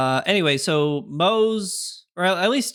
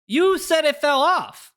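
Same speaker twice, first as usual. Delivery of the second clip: indignant exclamation; 10 semitones higher, 4 dB louder than usual. Real, not acted. Protesting at a chat comment.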